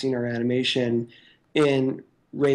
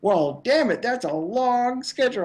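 high, put-on old man's joking grumble; playful imitation of an old man griping; mock grumpy old man; 10 semitones higher than usual. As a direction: ham up an old man's gripe. [name] joking back to Nathan.

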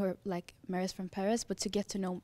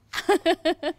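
laugh; 9 semitones higher, 11 dB louder than usual. Real, not acted. Laughs at own story.

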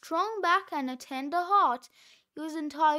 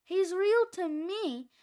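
normal talking voice

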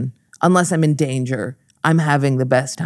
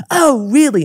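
mom's indignant retort; 9 semitones higher, 5 dB louder than usual. Retold or imitated. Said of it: "the mom comes up and goes".

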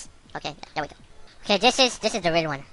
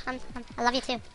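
normal talking voice

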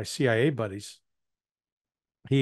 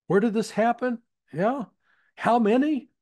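much higher, eager questioning; mock eager CIA buddy; 10 semitones higher, 3 dB louder than usual. CIA buddy to Iranian asset, high mock-eager questions. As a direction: play the keen handler pumping for details. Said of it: "my C I A buddy goes".

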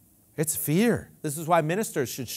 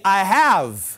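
call and response; 2 semitones higher, 7 dB louder than usual. Shown as a demonstration. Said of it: "Everybody say".

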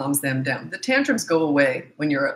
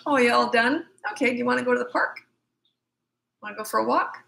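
higher, quick, light and casual offer; breezy calm parent; 6 semitones higher than usual. Pretend. Parent offering the park to a calmed child, light breezy tone. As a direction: brush it off as if nothing happened.

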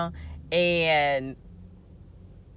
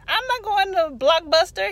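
high, loud indignant refusal; mock his outraged fussing; fussing brother; 13 semitones higher, 8 dB louder than usual. Brother refuses a detour to the video store, fussing. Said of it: "He fussed about that".

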